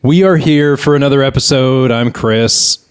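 ordinary talk

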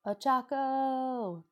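Ochako stretched out high and slow with delight; a long, happy high call of her name; excited greeting. A narrator's reading. Deku greets Uraraka on the phone, delighted.

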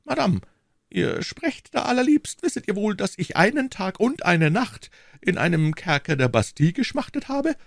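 high, hurt shopkeeper voice; a petty husband complaining to his wife; wounded husband. A narrator's reading. Gekränkter Ehemann.

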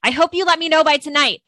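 high, loud demanding student; mock shouted demand. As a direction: yell it like an entitled kid. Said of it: "you're getting yelled at right now".

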